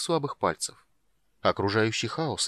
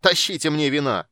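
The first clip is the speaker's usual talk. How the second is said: loud, high roar for wine; bellowed; 5 semitones higher, 7 dB louder than usual. A narrator's reading. Sturm bellows for wine as he starts to dance. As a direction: shout it like a reveller. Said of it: "заорал Стурм".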